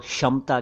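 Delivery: क्षमता said as "shamta", no k; wrong sh onset. Shown as a demonstration. Teacher quoting a second mistake: क्षमता as "shamta". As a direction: say क्षमता starting with bare sh. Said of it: "दोनों ही उच्चारण गलत हैं".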